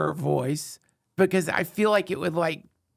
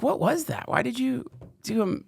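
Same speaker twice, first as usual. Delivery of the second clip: surprised question; 8 semitones higher than usual. Retold or imitated. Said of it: "I was like".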